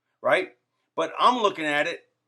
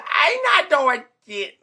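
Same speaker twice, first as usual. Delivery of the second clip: high, loud broken-English quote; Sofia Vergara broken English; 11 semitones higher, 7 dB louder, slower than usual. Retold or imitated. Wrestler imitating Sofia Vergara's broken English. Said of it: "She goes / broken English".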